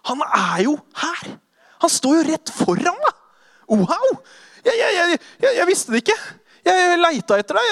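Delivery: high, stammering, breathless wonder; overwhelmed at finding him, words tumbling out; stunned joyful recognition. Acted. Disciple meeting risen Jesus, stammering in joyful awe.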